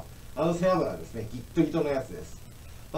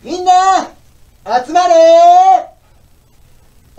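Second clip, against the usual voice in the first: shouted call; 20 dB louder, much slower than usual. Acted. Kids show call out.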